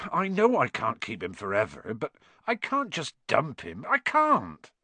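plea climbing to a high cry; anguished protest. Acted. Hagrid unable to dump his dragon, anguished. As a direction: start level, rise into helpless distress.

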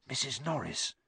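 hushed, barely voiced alarm; breathed. A narrator's reading. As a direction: breathe it very softly. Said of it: "breathed Ron".

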